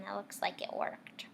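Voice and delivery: hushed knowing whisper; whispered aside. Real, not acted. Teacher whispering to kids that the joke worked. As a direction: whisper it with a smile. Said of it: "teacher aside to children".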